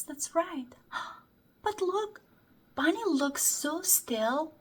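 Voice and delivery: usual speaking voice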